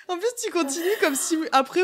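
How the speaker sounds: laughing. Real, not acted. Rit en parlant.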